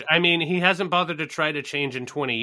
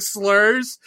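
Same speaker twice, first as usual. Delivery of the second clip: ordinary delivery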